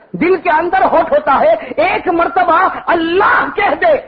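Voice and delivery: high, driving sermon delivery; impassioned preaching. Real, not acted. Preacher correcting youths who say chill out, impassioned exhortation.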